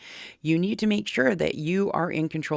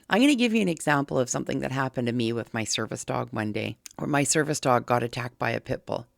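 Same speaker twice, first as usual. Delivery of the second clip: ordinary delivery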